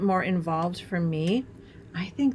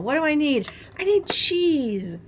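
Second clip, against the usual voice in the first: higher, slow teasing answer; mock self-tease; 7 semitones higher, 5 dB louder than usual. Wisconsin girl mocking her cheese craving, playful. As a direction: laugh at your own craving. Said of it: "I'm like".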